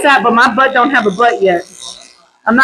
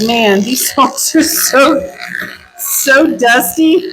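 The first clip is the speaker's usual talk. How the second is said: slow drawl; much slower than usual. Retold or imitated. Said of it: "And I was like".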